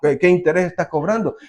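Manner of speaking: fast, anxious rush of errands; hurried worried scheming. Retold or imitated. Preacher mimics a churchgoer running off to the moneylender, fast and fretful. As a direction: rattle it off fast like someone who never trusted the prayer. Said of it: "después termina de orar".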